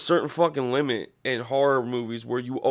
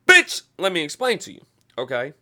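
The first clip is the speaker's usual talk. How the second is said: loud retort; 3 semitones higher, 7 dB louder than usual. Real, not acted. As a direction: bark it back at the doubters. Answering imagined doubters.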